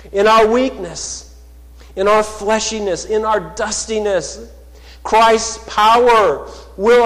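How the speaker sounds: loud, high, rising sermon voice, slowing on key words; fervent pulpit proclamation; full-force preaching. Acted. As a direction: preach it out to the whole church. Preaching climax on the cross.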